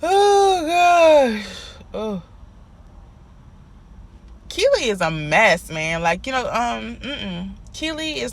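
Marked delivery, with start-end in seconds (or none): 0.0-2.2 much higher, louder "oh gosh" of disbelief; exasperated gasp. Real, not acted. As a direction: cry out in fed-up disbelief. Exasperated reaction to Keely's antics.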